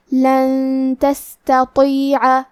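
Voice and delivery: لن تستطيع very slow, noon hidden nasal; ikhfa with ghunna. Shown as a demonstration. Teacher models ghunna on لن تستطيع, slowly. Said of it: "ہم یہ حکم کو غنہ کے ساتھ ادا کریں گے".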